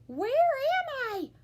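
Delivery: high, slow puzzled question; a high kitten voice, lost and curious; wondering aloud. A narrator's reading. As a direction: wonder it out slowly. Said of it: "wondered Scipito aloud".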